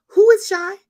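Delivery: demanding. Retold or imitated. Mother interrogating daughter on phone.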